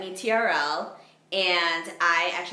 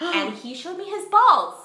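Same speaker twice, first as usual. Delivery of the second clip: excited; 9 semitones higher, 5 dB louder than usual. Real, not acted. Punchline of shocking story.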